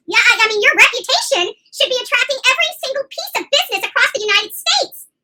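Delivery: ordinary delivery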